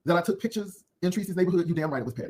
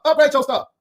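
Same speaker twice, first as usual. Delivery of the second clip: loud demand; 8 semitones higher, 7 dB louder than usual. Real, not acted. Telling a fading chain to upgrade, shouted.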